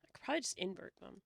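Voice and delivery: ordinary talk, nothing marked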